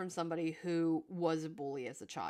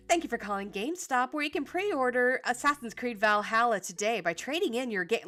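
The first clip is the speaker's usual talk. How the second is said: higher, louder robotic phone greeting; customer service voice; 5 semitones higher, 6 dB louder than usual. Shown as a demonstration. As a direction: recite it like an AI phone operator. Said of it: "my customer service voice sounds very A I".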